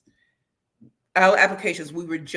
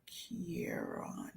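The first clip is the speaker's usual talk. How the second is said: whispered name, trying it out; soft whispered try; 3 semitones lower, 19 dB quieter than usual. Shown as a demonstration. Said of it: "I'm so sorry if I'm saying your name".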